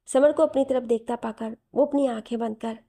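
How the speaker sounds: usual speaking voice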